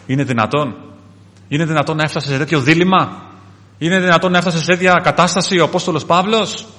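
building pulpit astonishment; incredulous preaching. Acted. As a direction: press the question harder each time.

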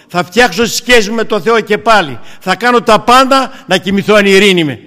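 loud, high, declaiming with full force; preacher crying out his resolve never to sleep apart from God; fervent preaching. Acted. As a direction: thunder out the vow, slowing on the key line.

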